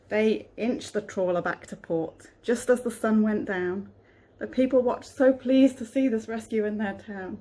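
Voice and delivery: storybook reading voice; reading to children. A narrator's reading. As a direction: read it gently to a child. Reads a picture book aloud.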